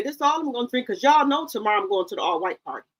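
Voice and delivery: usual speaking voice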